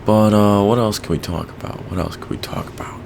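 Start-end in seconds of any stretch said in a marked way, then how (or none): 1.9-3.0 whisper. Shown as a demonstration.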